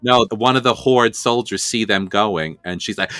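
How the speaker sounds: ordinary delivery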